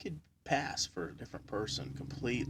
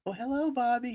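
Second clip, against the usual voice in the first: sweet hello; 11 semitones higher than usual. Retold or imitated. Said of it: "My ex-wife was like".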